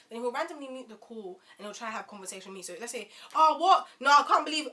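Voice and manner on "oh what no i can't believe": high, loud, put-out exclaiming; mock phone ranting. Imitating the date ranting to friends, loud disbelief. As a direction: imitate someone outraged on a call. Acts date's gossip on phone.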